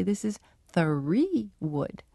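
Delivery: slow, deliberate trainer's voice; teacher modelling a label slowly; slow teaching voice. Retold or imitated. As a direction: lay out three wood slowly for the bird. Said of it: "and I'd say".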